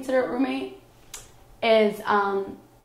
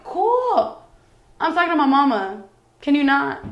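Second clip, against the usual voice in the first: annoyed brush-off; 6 semitones higher, 4 dB louder than usual. Retold or imitated. Annoyed at roommate during phone call.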